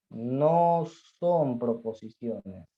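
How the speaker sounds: very slow, every word drawn out; slow dictation. Shown as a demonstration. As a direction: dictate it slowly for note-taking. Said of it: "teacher dictating slowly".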